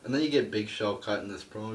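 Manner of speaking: normal talking voice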